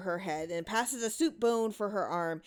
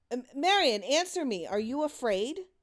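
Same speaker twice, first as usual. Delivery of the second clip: worried call; 7 semitones higher, 4 dB louder than usual. Acted. Mother calls missing daughter.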